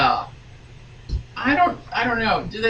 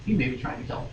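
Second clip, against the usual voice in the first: mock aside; 7 semitones lower, 8 dB quieter, much faster than usual. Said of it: "I added that".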